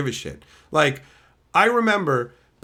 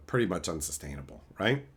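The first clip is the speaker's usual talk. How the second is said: ordinary talk, nothing marked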